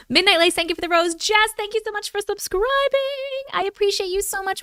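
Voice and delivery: high, excited thanks; bright thank-you. Real, not acted. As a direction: gush thanks at the viewers. Thanking gift givers live.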